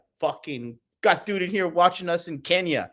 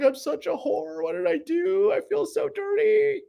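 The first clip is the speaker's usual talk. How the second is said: put-on much higher whiny girly voice; mock woman confessing. Mocking a woman on Doctor Phil, high whiny voice. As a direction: whine it like a tearful talk-show guest, hammed-up.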